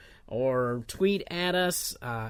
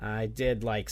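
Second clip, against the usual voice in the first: ordinary delivery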